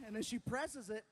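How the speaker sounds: normal talking voice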